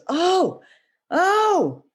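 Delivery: two high, drawn-out ohs; acted sudden realization. The farmer wakes up from his dream, astonished. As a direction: play a wide-eyed waking revelation.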